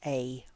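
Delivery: "A" said as the full vowel "ay"; full letter name. Shown as a demonstration. Teacher points out the word, "A" said in full. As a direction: say "A" like the letter, on its own. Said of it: "and how I say this".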